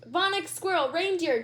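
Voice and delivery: spouting. Acted. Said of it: "Judy spouted".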